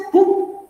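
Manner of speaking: a quick, high whispered "pum"; injection sound effect. Retold or imitated. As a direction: snap out the jab like a needle going in. Mimics the injection hitting. (efecto de pinchazo susurrado y agudo)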